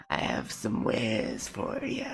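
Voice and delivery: secretive raspy sales murmur; lightly voiced shady trader, slow and soft; shady merchant voice. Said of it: "the shady merchant".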